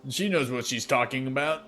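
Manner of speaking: a lightly voiced half orc teasing his friend; gruff half orc. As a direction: cheer the woman on, loudly. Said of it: "the half orc is like".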